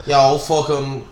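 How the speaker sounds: ordinary delivery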